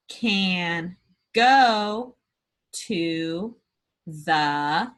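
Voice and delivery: word by word with pauses; choral reading. Shown as a demonstration. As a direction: read slowly, word by word. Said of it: "Let's read it together".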